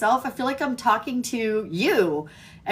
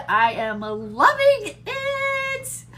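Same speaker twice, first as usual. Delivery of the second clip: excited; 11 semitones higher, much slower than usual. Real, not acted. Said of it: "so stinking excited".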